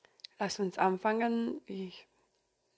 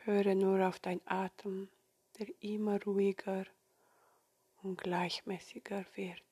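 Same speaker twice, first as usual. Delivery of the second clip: soft spa whisper; 9 dB quieter than usual. Acted. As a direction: whisper them gently into calm. Leads a guided meditation.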